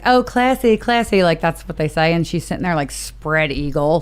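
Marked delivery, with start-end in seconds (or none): 0.0-1.2 higher, mock "classy" quote; mocking impression, raised in pitch; imitated put-on accent. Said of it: "she's like".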